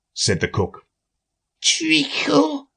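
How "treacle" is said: high, soft and drowsy; sleepy voice. Acted. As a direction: mumble it half asleep. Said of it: "said a sleepy voice behind her".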